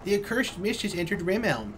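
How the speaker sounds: high dismayed cry; alarmed villager. Acted. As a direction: gasp in horror at the mist. Voicing alarmed villager reading.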